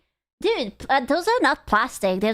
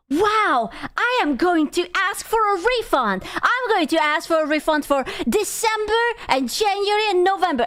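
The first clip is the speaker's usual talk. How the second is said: higher, whiny demanding customer voice; lightly voiced entitled fan demanding a refund; mock entitled viewer; 6 semitones higher than usual. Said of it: "someone was like".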